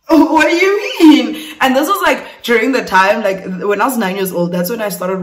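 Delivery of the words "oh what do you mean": high, loud, drawn-out question; acted baffled outburst; alarmed disbelief. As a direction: blurt it out slowly in high-pitched disbelief. Acted alarmed reply to the man.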